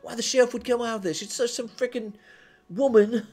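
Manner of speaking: high, fast, panicky babble; frantic rant. Acted. Henchman ranting about the sheriff, high and panicked. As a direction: spill it all out in a nervous rush.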